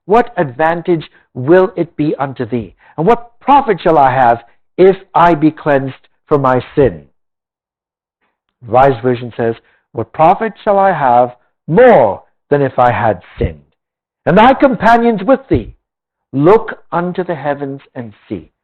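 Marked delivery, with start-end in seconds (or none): 0.1-7.1 measured, solemn Bible reading; scripture reading. Acted. Preacher reading Job to the congregation, solemn scripture voice. Reads Job aloud in sermon. 9.9-18.5 measured, solemn Bible reading; scripture reading. Acted. Preacher reading Job to the congregation, solemn scripture voice. Reads Job aloud in sermon.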